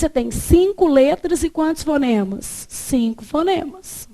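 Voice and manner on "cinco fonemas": usual speaking voice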